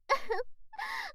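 high mocking cackle; mocking laugh. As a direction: laugh at him, teasing. Mocking laugh at his arousal.

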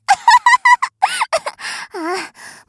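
long, very high mocking cackle; mocking high laugh. Spoiled young mistress laughing at her servant's humiliation, a long high cackle. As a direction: cackle down at him in delight.

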